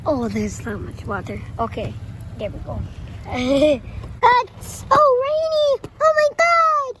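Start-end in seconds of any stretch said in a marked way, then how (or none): 4.2-6.9 excited shout. Real, not acted. Kid shouting in sudden rain.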